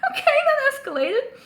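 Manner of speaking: high, loud, through real laughter; cracked-up amused disbelief; laughing. Real, not acted. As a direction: squeeze the words out mid-laugh. Still cracking up at comic.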